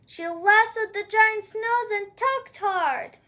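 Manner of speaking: plain reading voice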